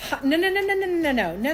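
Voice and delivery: rapid high no no no; panicked denial. Retold or imitated. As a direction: refuse it in alarm. Denying the marriage in panic.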